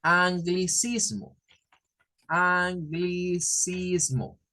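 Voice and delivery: anglicismo said slowly, syllable by syllable; slow careful word. Shown as a demonstration. Teacher spelling out a new word slowly. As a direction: stretch out anglicismo slowly for the class. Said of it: "se conoce como un".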